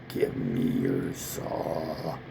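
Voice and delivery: very low, slow menacing growl of a voice; slow, dark, demonic tempter voice; sinister deep voice. Retold or imitated. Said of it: "They're like".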